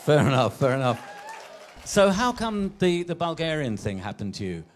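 normal talking voice

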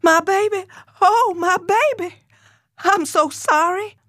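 high, wailing mother's anguish; anguished cry. A narrator's reading. Mother wails in anguish over her beaten daughter, very high. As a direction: cry out in shock at the bruised face. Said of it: "She screamed and reeled".

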